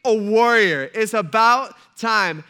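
pumped-up, booming excitement; high and loud, stretch it out triumphantly; Saul. Retold or imitated. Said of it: "Saul is excited. He is pumped".

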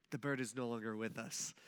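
soft, low, careful delivery; hushed and low, as if offering condolences; gentle, tactful version. Retold or imitated. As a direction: break bad news delicately, low and hushed. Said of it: "what I should have said is".

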